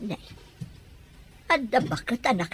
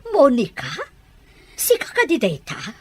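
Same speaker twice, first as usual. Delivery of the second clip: louder, startled cry of a name; shocked recognition; 3 semitones higher, 6 dB louder than usual. Acted. Older sister suddenly recognizing her sick sister, shocked. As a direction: gasp it out in disbelief.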